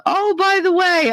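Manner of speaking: high sing-song imitation; mock breezy announcement. Imitating a relative announcing a visit, mock breezy. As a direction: toss it in high, like an afterthought.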